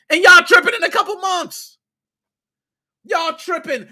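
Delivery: high, loud exasperated scolding; exasperated yell. Real, not acted. Vlogger scolding Battlefield fans who overreact, loud exasperated yelling. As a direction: shout them down in disbelief.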